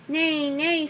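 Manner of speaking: lightly voiced horse. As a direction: neigh gently like a storybook horse. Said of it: "said the horse".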